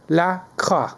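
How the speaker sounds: La Croix said the French way, lah KWAH; French accent. Shown as a demonstration. Host showing how he says the brand: French lah KWAH. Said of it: "like the French might say it".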